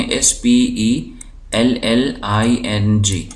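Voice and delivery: slow, letter-by-letter spelling; name each letter slowly and separately. Shown as a demonstration. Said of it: "spelling کے اندر یہ دیکھیں". (ایک ایک حرف آہستہ بول کر ہجے کرنا)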